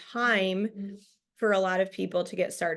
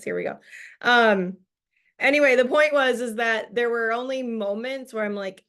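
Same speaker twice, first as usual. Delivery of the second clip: ordinary talk, nothing marked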